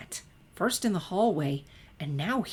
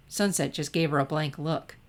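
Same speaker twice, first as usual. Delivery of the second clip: ordinary delivery, nothing marked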